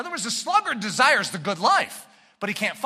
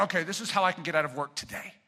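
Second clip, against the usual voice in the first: sly excuse maker; 5 semitones lower, 6 dB quieter, faster than usual. Acted. Sluggard scheming an excuse.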